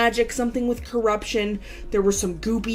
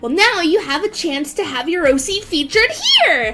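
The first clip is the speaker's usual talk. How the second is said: higher, excited character read; Akira voice; 7 semitones higher than usual. Acted. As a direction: read it bright and bubbly as Akira. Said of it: "continue reading this in, uh, my Akira voice".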